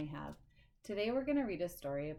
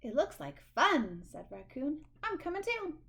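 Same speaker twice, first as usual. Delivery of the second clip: eager raccoon; 7 semitones higher, 5 dB louder than usual. Acted. Raccoon voiced in story.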